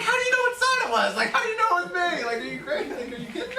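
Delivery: incredulous inspector protesting to a client, high and laughing; exasperated disbelief. Retold or imitated. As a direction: sputter it like you can't believe the accusation. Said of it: "I'm like".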